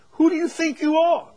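high, loud indignant demand; emphatic challenge. Acted. Preacher voices the verse's rebuke to judgers, emphatic challenge. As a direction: raise the voice sharply, challenging them. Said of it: "being emphatic, the idea is".

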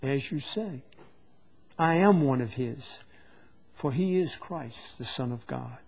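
subdued, measured admission; calm confession. Acted. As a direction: answer the accusers softly and steadily. Acts Peter's calm answer to accusers.

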